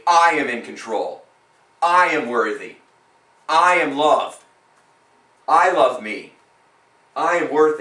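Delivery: loud, slow, certain I AM statements; bold spoken affirmations. Shown as a demonstration. Coach showing client how to say affirmations, loud and proud. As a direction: declare it to the mirror with full conviction. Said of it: "and you say".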